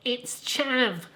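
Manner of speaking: put-on squeaky Southern accent on "chav". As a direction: insist again in a squeaky Southern voice. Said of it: "in my squeaky Southern voice".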